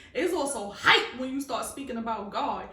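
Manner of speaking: ordinary talk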